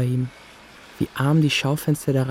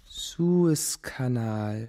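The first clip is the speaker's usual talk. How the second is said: slow reading aloud; much slower than usual. Acted. Boy alone with dictionary, slowly reading headwords aloud. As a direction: sound out each syllable slowly.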